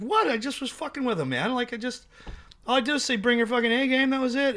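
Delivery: high, fast what-did-I-do protest; a mock wounded innocent, pitched well up. Acted innocent self-defence.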